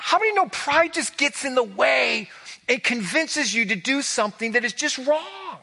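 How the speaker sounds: rising preacher's fervor; fervent preaching. Real, not acted. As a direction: drive home the danger of pride. Preaching climax on pride.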